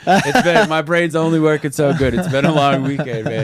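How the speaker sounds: giggly stoned apology; giggling. Real, not acted. Stoned host laughs at himself.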